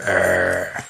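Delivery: low soft growl like Vader under his brow; mock growl. Podcaster imitating Anakin's brooding glare, low growl. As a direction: growl it quietly, glowering. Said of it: "Like his dad looking under his brow".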